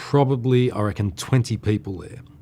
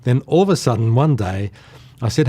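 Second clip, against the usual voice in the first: normal talking voice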